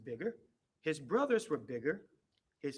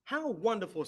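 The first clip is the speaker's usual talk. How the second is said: delighted; 5 semitones higher, 5 dB louder than usual. A narrator's reading. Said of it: "said his mother".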